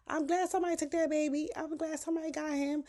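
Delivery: high, quick imitated neighbor voice; nosy neighbor. Neighbor relieved someone took the baby, high imitated voice. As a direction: pipe up high and fast as an onlooker.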